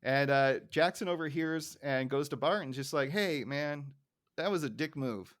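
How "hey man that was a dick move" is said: usual speaking voice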